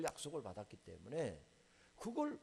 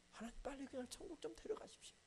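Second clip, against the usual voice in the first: softer, higher desperate plea; a soft, desperate prayer; hushed plea; 9 semitones higher, 10 dB quieter than usual. Retold or imitated. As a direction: beg quietly to take him home. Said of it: "분노가 일어나서".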